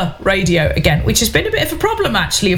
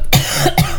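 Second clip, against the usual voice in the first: sudden coughing fit mid-sentence; choking cough. Real, not acted. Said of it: "oh, sorry, just choking".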